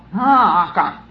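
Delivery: loud, drawn-out mock yell; imitating a three-year-old's loud call; imitated child's yell. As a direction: yell out like a child summoning everyone to look. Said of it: "she would be yelling out".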